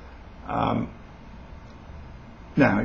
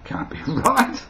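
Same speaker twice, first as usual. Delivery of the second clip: high-pitched, incredulous listener; acted shock with rising tune, breaking into a laugh; mock disbelief; slower than usual. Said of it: "people go".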